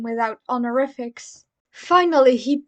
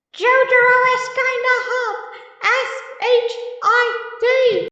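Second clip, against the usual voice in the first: much higher, louder voice of a cheeky stand; stand creature voice; 12 semitones higher, 5 dB louder than usual. Acted. Echo's Act Three teasing Jotaro in a high creature voice. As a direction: squeak it out as a mischievous spirit creature.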